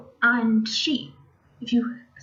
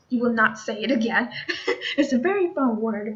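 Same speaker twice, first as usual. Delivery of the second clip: amused laughing; faster than usual. Real, not acted. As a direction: talk through a small laugh. Amused by her tongue twister example.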